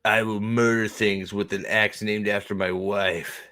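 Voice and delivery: slow, low solemn vow; mock brooding Perrin. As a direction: intone it with hammy gravity. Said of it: "Be like".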